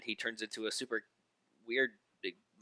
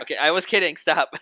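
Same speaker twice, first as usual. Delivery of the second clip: high, loud plea to quit; loud protest; 7 semitones higher, 13 dB louder than usual. Real, not acted. Begging a friend to stop the voice, loud protest. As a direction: cut in loudly over the performance.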